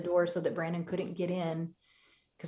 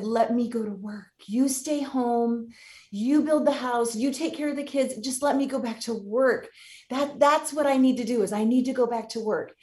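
higher, pressing plea; urgent plea; 5 semitones higher, 4 dB louder than usual. Retold or imitated. Wife pleading with husband to swap roles, higher urgent plea. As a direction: press him to let her work.

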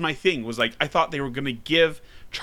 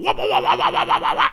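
imitating Mario's wah; faster than usual. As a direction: bark out Mario's game grunts. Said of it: "like he does all the time".

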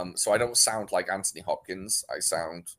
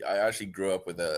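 ordinary delivery

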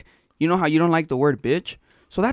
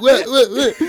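mock tough bravado; 6 semitones higher, 6 dB louder, slower than usual. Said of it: "Knowing damn well the teacher was in front".

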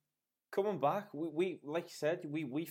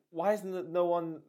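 getting louder and higher with disbelief; rising amazement; 7 semitones higher, 8 dB louder than usual. Real, not acted. Talking about the busker from Williamson Square, incredulous and excited. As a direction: build up, astonished and insistent.

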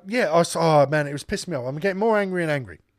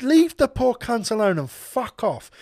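slow, raised-pitch angry telling-off; lost temper; 7 semitones higher, 4 dB louder, slower than usual. Retold or imitated. Storyteller defending the old cashier from a customer, retold anger. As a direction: re-enact an emphatic, fed-up dismissal. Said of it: "I just lost my temper".

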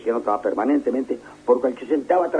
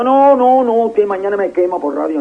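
loud, higher protest; mock insistent refusal; 9 semitones higher, 6 dB louder than usual. Retelling the doorman refusing his ID card, loud and high. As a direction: wave him off emphatically.

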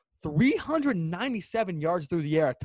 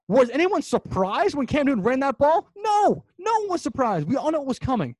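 higher-pitched, incredulous outburst; exasperated rant; 6 semitones higher than usual. Real, not acted. Fan ranting about the predictable play call, exasperated. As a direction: rhetorical question answered with a high, emphatic no.

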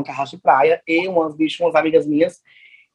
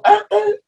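"ah, ah" agudo e sufocado, imitando o mudo; 4 dB louder than usual. Retold or imitated. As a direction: grunhido alto e agudo de quem não fala. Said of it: "e o mudo". (imitação aguda dos grunhidos do mudo)

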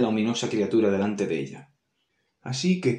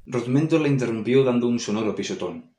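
ordinary delivery, nothing marked

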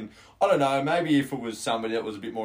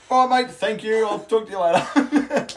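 higher, louder, giggling goodbye; laughing sign-off; 10 semitones higher, 8 dB louder than usual. Retold or imitated. Retelling ending an awkward call, higher, louder and giggling. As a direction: rush off the phone laughing. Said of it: "And I'm sitting there going".

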